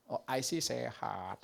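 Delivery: a hint of a laid-back teenager; lightly voiced Gen Z kid. Gen Z kid answering an elder, slow and casual. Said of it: "Anak Gen Z ngomongnya gitu".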